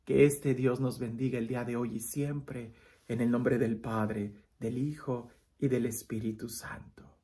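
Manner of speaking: priestly blessing, low and soft; blessing prayer. Acted. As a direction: bless them gently and solemnly. Closing blessing in a sermon.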